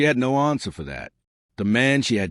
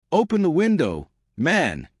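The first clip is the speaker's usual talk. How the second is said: raised pitch, mildly frantic demand; sharp, frantic demand; 7 semitones higher than usual. A narrator's reading. Husband at the truck window, mildly frantic demand. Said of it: "his voice sharp, frantic".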